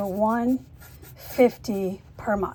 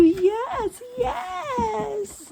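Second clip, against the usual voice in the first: gushing pet voice, much higher and louder; puppy talk; 8 semitones higher, 5 dB louder, much slower than usual. Real, not acted. Greeting the puppy lovingly.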